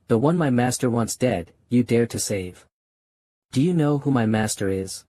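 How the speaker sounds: ordinary delivery, nothing marked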